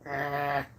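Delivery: low grumbly growl like a grumpy dog; mock dog growl. Imitating Sandy growling when she is hugged, a low mock growl. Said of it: "she's like".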